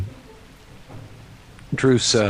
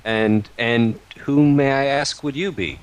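mock-polite question; 6 semitones higher, 4 dB louder than usual. Druce questions the brigand.

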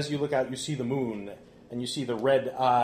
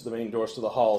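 usual speaking voice

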